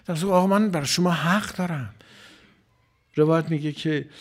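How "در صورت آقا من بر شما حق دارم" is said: higher, louder plea, calling out for his right; a preacher voicing a desperate plea; pleading cry. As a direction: cry out to the Imam that he has a claim. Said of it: "فریاد زد".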